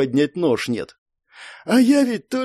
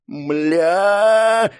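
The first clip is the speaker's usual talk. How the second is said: high, stretched-out yell; inward yell; 6 semitones higher than usual. A narrator's reading. Hero as the spell hits him, yelling in his head. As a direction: let the curse out as a long cry. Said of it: "ору в мыслях".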